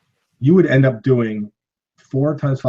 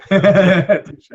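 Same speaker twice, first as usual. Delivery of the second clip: Touche louder and higher, drawn out; playful emphatic; 6 semitones higher, 6 dB louder than usual. Real, not acted. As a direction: Touche said high and loud, playful. Conceding a point, laughing.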